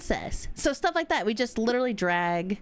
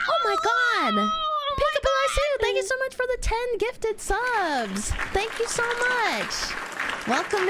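excited squeal; 10 semitones higher than usual. Real, not acted. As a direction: gush over the gift. Thanks for gifted subs.

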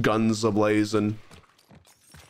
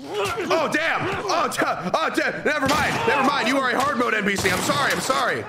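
very high frantic squeal; panicking gamer. Real, not acted. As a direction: squeal in panic as the fight turns. NPC fights back, panics.

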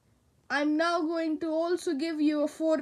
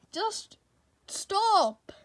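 normal talking voice

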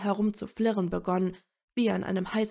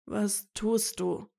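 slow, helpless question; understated pitiful plea; plaintive; slower than usual. A narrator's reading. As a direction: ask it feebly, drawn out. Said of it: "wiederholte er kläglich ein drittes Mal".

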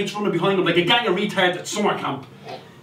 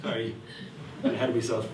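much softer, lower apology; quiet aside; 11 semitones lower, 12 dB quieter, faster than usual. Real, not acted. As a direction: step back and apologise under your breath. Aside after the rant.

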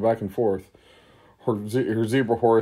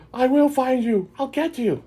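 much higher, louder trapped goblin calling through the bars; Ojin's high vow; 4 dB louder than usual. Acted. Ojin shakes the bars and calls to his friends in a high, loud voice. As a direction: shout the promise up high like a caged goblin.

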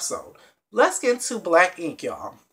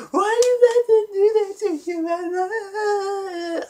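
high, slow, hammed-up crying voice; mock crying wail; 14 semitones higher, 4 dB louder, much slower than usual. Vlogger mimics Kathy crying, high hammed-up wail. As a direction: wail it like an exaggerated sob.

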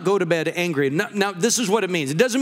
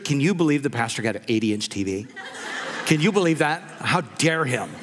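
a light, lower mock gossip voice; scandalised churchgoer whispering about the pastor's TV; 6 semitones lower than usual. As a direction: play a tutting church busybody, half laughing. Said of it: "That would be like people at church".

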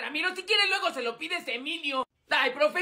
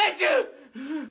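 fake sneeze; 3 semitones higher than usual. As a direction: sneeze it out big.

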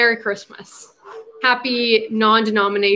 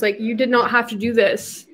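ordinary talk

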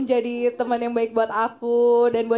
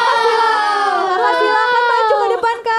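calling out; 7 semitones higher, 7 dB louder than usual. Real, not acted. Calling friend forward loudly.

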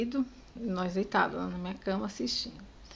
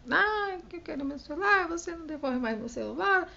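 mock crying whine, pitched up high; choramingo imitado; 8 semitones higher than usual. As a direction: whine like a little girl pretending to cry. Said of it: "aí começou a chorar". (imitando a filha chorando, voz aguda e chorosa)

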